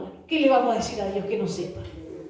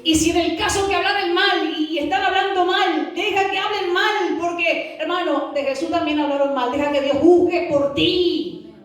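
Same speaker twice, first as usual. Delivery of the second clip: high-pitched, driving sermon delivery; fervent preaching; 4 semitones higher than usual. Real, not acted. Preacher urging the congregation to let others talk, fervent. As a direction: preach it high, drawing out the last words.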